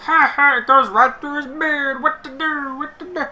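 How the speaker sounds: high, loud goofball squeal; silly commentary voice. Acted. Solo commentator mocking a wrestler's look, silly high voice. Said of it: "sit here and be like".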